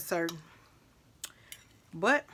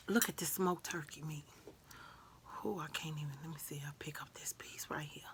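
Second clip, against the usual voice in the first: whispering; 4 semitones lower, 14 dB quieter than usual. Real, not acted. Whispering over food to viewers.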